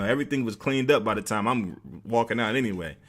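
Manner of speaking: ordinary talk, nothing marked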